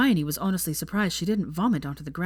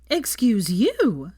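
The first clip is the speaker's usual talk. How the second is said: offended; 4 semitones higher, 5 dB louder, much slower than usual. A narrator's reading. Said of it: "looking deeply offended".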